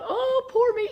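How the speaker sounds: sarcastic wail mocking her own self-pity. To viewers, mocking the idea she cries for pity, high whiny wail. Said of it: "It's not about".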